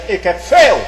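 a touch of a proud boaster; preacher voicing Esau's mild boast. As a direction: boast it, mildly loud. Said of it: "Esau zegt".

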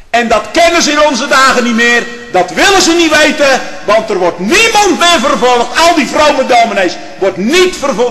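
loud, high, impassioned pulpit oratory; building, shouted sermon denunciation; fiery preaching at full force. Real, not acted. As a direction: thunder the accusation at the congregation.

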